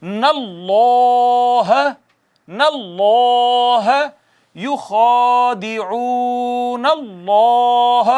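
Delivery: اللَّهَ with a full, heavy L, slow chant; slow tajweed recitation; heavy Allah recitation. Shown as a demonstration. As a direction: recite نَاللَّهَ with a thick, full lam. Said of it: "موٹا کر کے پڑھتے ہیں".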